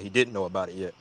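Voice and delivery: ordinary delivery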